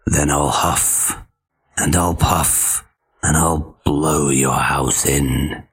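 low, slow growl; big bad wolf threatening. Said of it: "growled the wolf".

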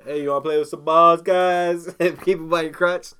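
loud teasing kiosk seller's pitch; mock kiosk seller. A kiosk seller calling to shoppers at the mall, loud mock pitch. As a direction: hawk the balls to passing guys. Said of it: "keep 'em near your crotch".

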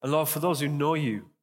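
earnest congregational prayer voice; spoken prayer. Acted. Pastor praying over the room, earnest and steady. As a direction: pray aloud for the room, heartfelt.

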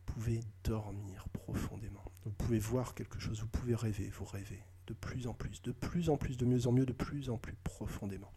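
soft, slow hypnotic induction; slow hypnotist voice. Shown as a demonstration. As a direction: lull them gently into trance. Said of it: "Voilà, par exemple". (voix d'hypnotiseur lente et douce)

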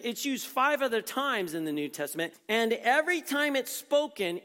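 ordinary talk, nothing marked

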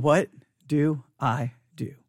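slow, low whisper to himself; retold helpless whisper. Pastor acting himself sitting in the sanctuary, helpless whisper. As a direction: whisper it slowly, as if lost.

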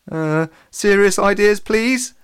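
high, slow, anxious appeal; worried demand. A narrator's reading. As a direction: demand it with a worried upward pitch. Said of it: "Alex demanded, looking a little worried".

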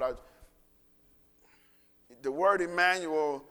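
usual speaking voice

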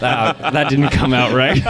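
sheepish laugh through the words. Real, not acted. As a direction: laugh at your own slip. Catches own awkward phrasing, laughing.

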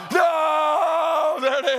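sudden high shouted mock No; hammed-up wail of disappointment; mock anguished yell. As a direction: play up the outrage over a broken machine. Mock reaction to milkshake.

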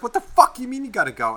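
high-pitched incredulous mock outrage; retold outrage. Player challenges kid who must leave, retold outrage. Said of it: "you're just like".

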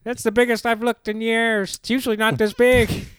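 goofy put-on voice; silly high voice. Joking in funny voice.

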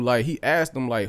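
normal talking voice

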